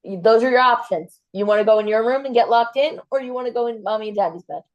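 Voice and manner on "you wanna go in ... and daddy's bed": ordinary delivery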